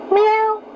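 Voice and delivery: high, bright meow; cat meow. Shown as a demonstration. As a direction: meow like a kitten. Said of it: "Can you meow like a cat?".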